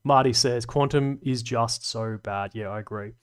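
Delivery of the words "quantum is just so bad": usual speaking voice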